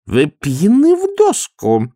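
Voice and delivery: high, slow accusing voice; indignant accusation. A narrator's reading. Biggs accusing Vimes of being drunk, high and slow. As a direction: spit out the accusation. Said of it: "сказал мистер Бикс".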